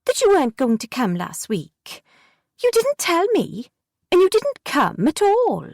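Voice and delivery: put-on high, whiny child's reproach; peevish. A narrator's reading. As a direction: complain like a sulky girl. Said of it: "she said peevishly".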